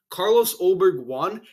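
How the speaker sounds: usual speaking voice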